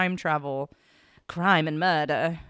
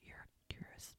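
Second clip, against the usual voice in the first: whispered insult; 5 semitones higher, 20 dB quieter than usual. Pretend.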